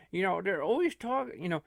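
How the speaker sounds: normal talking voice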